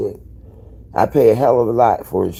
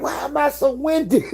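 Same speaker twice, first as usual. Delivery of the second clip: high retold question. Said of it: "I kept saying".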